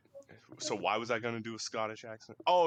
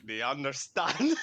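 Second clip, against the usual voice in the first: slow imitated Glaswegian, laughing; louder Glasgow accent breaking into laughter; 2 semitones higher, 5 dB louder, slower than usual. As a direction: drawl it in mock Glaswegian. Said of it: "The Glaswegian accent?".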